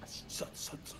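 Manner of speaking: teasing whisper. Pretend. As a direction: whisper it to spook him. Teasing whisper to scared friend.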